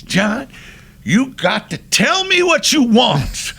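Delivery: high, loud, hammed-up showman's delivery; exuberant instruction. Singer with million-dollar smile to director, exuberant. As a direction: belt it out with big showy delight. Cab finally beams at director.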